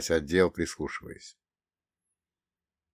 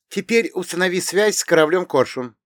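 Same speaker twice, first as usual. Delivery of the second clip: commanding heroine; 10 semitones higher, 5 dB louder than usual. Acted. Irina gives commands.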